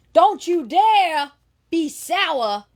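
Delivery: a touch of Big E, high and slow; mock-preach his catchphrase lightly; lightly imitating Big E. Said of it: "it's an imitation of him".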